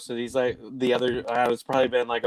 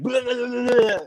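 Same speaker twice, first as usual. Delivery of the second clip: higher, playful blah-blah babbling like a cartoon devil; mock Tasmanian devil babble; 12 semitones higher than usual. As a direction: rattle off gibberish like the Tasmanian devil, half in fun. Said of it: "she texts like the, uh, Tasmanian devil too".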